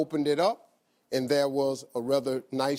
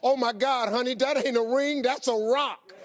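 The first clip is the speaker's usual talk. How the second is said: higher, sassy admiring woman's voice; impressed stranger; 8 semitones higher than usual. Retold or imitated. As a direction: marvel at the big rock like a brassy lady. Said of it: "She said".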